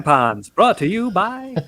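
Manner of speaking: high, slow mock sponsor announcement; lightly voiced old-time ad man; radio announcer. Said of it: "start talking like that again".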